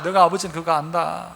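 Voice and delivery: ordinary delivery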